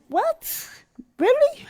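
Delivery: put-on a child who can't believe his ears; amazed little boy. Boy hears he has the right to be mad, amazed high squeal.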